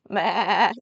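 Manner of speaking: a loud mock "Baa" like a sheep; sheep bleat. Shown as a demonstration. Grandma shows the child a loud bleating "Baa". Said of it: "something like that".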